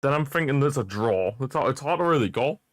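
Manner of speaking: ordinary talk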